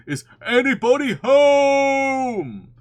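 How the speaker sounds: long, drawn-out high holler; very loud calling. A narrator's reading. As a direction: bellow it slowly, stretching the last word. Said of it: "called out Pooh very loudly".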